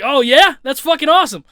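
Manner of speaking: high, loud retold excitement; re-enacted delighted outburst; excited reaction. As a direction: act out being thrilled by news. Acts out reaction to good news.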